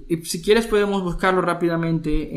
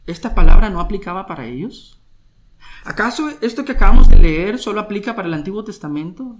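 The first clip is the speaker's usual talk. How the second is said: forceful questions; 4 semitones higher, 11 dB louder, faster than usual. Acted. Preacher's challenging questions.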